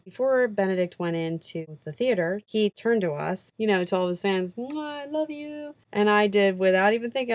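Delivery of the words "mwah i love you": higher, slow, softer mock kiss and "love you"; light imitation of a celebrity blowing a kiss; imitating a blown kiss. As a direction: blow the kiss back like the star did. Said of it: "mimic them".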